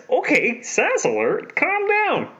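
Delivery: high-pitched playful telling-off; mock scolding. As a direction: rein him in with a squeaky, teasing rebuke. Mock scolding the sassy villain.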